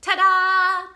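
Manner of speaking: like a magician finishing a trick. Pretend. Vlogger plays a magician revealing thought put on paper, loud reveal. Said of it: "That's a magician".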